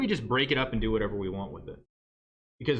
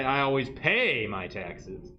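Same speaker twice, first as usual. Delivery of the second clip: slow, with "pay" stretched and lifted; stress on PAY; much slower than usual. Shown as a demonstration. Teacher showing emphasis changes meaning, stress on "pay". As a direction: lean hard on the word "pay".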